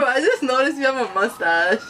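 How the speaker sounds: words broken by laughter; cracking up mid-sentence; laughing. Real, not acted. Teasing friend about mustache.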